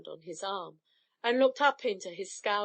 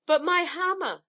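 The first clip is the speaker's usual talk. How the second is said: higher, louder insistence; insisting; 8 semitones higher, 5 dB louder than usual. Acted. Thor demands his hammer back, insisting loudly. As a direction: press the point hard. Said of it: "insisted Thor".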